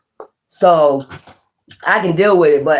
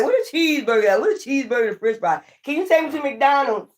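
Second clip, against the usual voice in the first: whiny cheeseburger plea; 7 semitones higher, 5 dB quieter, faster than usual. Retold or imitated. Voicing herself at fancy dinner.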